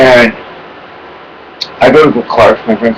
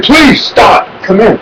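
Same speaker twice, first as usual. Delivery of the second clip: high, mild mock command from a cop; imitating a cop telling him to halt; retold cop command; 8 semitones higher, slower than usual. Cop orders him to stop.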